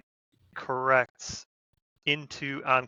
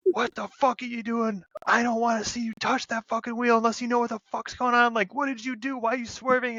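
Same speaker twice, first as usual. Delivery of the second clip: much higher, yelling angrily in a retold rant; retold furious yelling; 11 semitones higher than usual. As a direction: blow up at the sleepy driver, high and harsh. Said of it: "the squad leader loses it".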